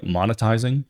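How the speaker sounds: ordinary delivery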